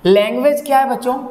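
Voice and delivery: normal talking voice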